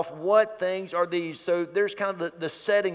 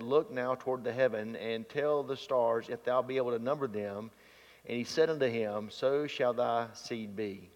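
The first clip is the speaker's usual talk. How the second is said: measured, lower and softer Bible reading; scripture reading; 6 semitones lower, 7 dB quieter than usual. Acted. Preacher reads scripture to congregation, measured reading. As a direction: read the passage evenly and solemnly.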